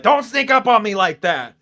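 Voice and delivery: high, loud mock startled yell; hammed-up startled protest; startled Yuji. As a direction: jump and complain. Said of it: "Yuji was like".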